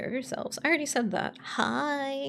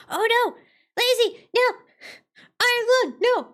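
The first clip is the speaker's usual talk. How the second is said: high, loud outburst of dismay; alarmed cry; 12 semitones higher, 7 dB louder than usual. Real, not acted. Streamer reacting to a redeem on screen, alarmed cry. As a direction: cry out in short high bursts.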